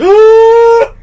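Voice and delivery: sharp high gasp for air; big gasp. Pretend. Acting out holding his breath in a sketch, a loud gasp. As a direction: suck in a huge loud breath. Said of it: "my plan was to hold my breath".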